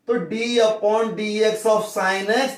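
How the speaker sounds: spelled out very slowly for notes; teacher dictating at writing speed; slow dictation. Shown as a demonstration. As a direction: say it slow enough to write down.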